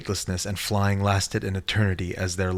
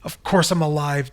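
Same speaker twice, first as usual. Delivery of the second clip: higher, snippy retort; with attitude; 8 semitones higher, 4 dB louder than usual. Acted. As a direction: answer with sass. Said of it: "answered with an attitude".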